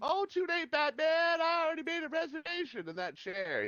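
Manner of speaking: very high, gleeful cartoon villain voice; taunting villain. Villain gloating at Batman, very high and gleeful.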